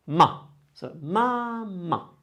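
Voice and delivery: ma held, then a gap; pause after ma. Shown as a demonstration. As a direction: leave a small silence after ma. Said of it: "here there's a gap".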